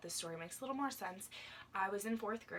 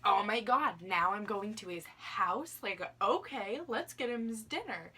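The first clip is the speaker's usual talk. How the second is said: excited; 3 semitones higher, 8 dB louder than usual. Retold or imitated. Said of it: "It was like".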